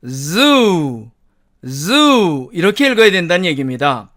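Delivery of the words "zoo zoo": "zoo" with a buzzing Z, long falling vowel; buzzed initial Z. Shown as a demonstration. Teacher corrects a J-like reading, buzzed Z. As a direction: buzz into "zoo" and draw it out. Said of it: "Z가 아니고요".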